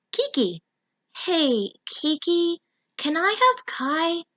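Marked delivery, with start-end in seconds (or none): 1.2-4.2 higher, slow, timid voice; Mimi asking. Acted. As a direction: ask slowly and shyly, pitched up. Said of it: "Mimi asked".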